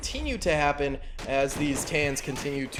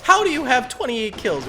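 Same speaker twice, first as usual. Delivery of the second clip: disbelief; 8 semitones higher, 5 dB louder than usual. Real, not acted. Stunned by kill count.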